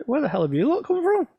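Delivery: high, loud, fast startled exclamation; startled outburst. Retold or imitated. Surprised dad finds the hide full of photographers, high startled outburst. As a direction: blurt it out in shock at the packed hide. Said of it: "I'm like".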